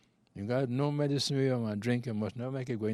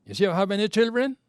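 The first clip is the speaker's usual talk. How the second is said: raised quoted voice; 8 semitones higher, 10 dB louder than usual. Retold or imitated. Mister Bailey voiced in retold story.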